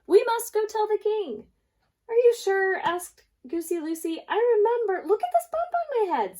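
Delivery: panicked birds urging; frantic insisting. Acted.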